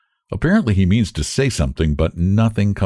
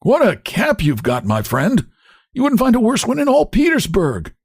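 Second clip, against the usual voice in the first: cracked voice; 14 semitones higher than usual. A narrator's reading. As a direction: bark it out in a cracked voice. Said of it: "cracked voice".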